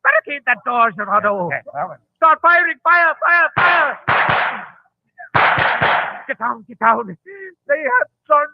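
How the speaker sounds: high, loud frantic yelling in the dark; panicked shouting. Acted. Gang leader yelling orders as police storm the shop, panicked shouting. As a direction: shout orders as the raid breaks in.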